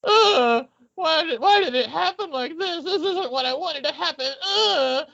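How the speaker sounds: put-on high-pitched whiny teenager voice; whiny Riddler. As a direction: whine it like a sulky teen villain. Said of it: "there's a part where he's like".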